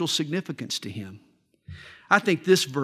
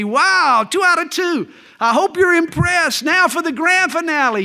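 much higher, louder crowing at the maitre d'; boastful excited shout; 10 semitones higher, 6 dB louder than usual. Acted. Dog owner crows over his talking dog's wins, excited and loud. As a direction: gloat like you've already won the bet.

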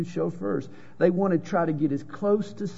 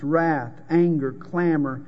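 usual speaking voice

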